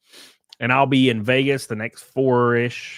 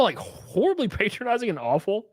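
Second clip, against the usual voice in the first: normal talking voice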